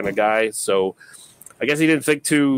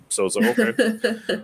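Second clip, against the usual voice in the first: laughing; 8 semitones higher than usual. Real, not acted. Laughing reaction.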